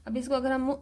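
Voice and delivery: ordinary talk, nothing marked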